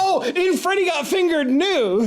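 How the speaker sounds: high, grand radio announcer voice; old-timey radio announcer. Acted. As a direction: open like a vintage radio bulletin. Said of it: "I'm doing an old-timey radio announcer".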